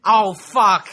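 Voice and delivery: loud, high shout of dismay; dread shout. Real, not acted. Hearing the bad film topic, shouting in dismay. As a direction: yell it in mock horror.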